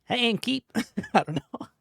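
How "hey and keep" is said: high, loud mock greeting; put-on high voice, hailing someone; innkeeper greeting voice. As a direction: try out a silly high-pitched call to the innkeeper.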